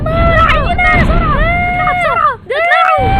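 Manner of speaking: high shouted call for help; panicked shout. Acted. Daughter yells to her mother for help, panicked shout. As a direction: yell for mum to come quick.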